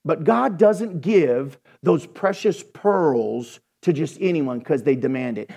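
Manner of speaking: usual speaking voice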